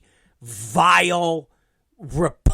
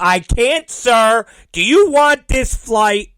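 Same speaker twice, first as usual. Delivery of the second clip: loud, high, cutting in; mock fed-up agent; 7 semitones higher, 6 dB louder, slower than usual. Agent refusing passenger and offering flight, mock fed-up. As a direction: imitate an agent overriding the customer.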